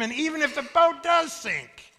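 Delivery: high, loud, rising declaration; preaching climax. Real, not acted. Preacher reassuring congregation about the boat, climactic shout. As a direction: lift it to a ringing peak. Sermon climax before quiet punchline.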